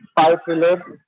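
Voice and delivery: ordinary talk, nothing marked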